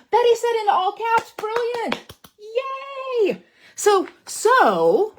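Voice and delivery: high, loud squeals of delight; thrilled. Real, not acted. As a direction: burst with joy at the praise. Thrilled by viewer comment.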